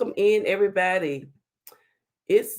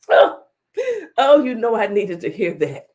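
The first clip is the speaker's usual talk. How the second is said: laughing delight; 7 dB louder than usual. Real, not acted. Touched by viewer's compliment.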